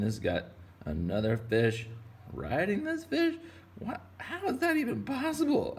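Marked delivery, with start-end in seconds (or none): 2.3-4.0 leaps way up in surprise; surprised squeak. Real, not acted. Surprised at stacked fish. 4.2-5.8 high, squeaky disbelief; amazed high voice. Real, not acted. Amazed at own drawing.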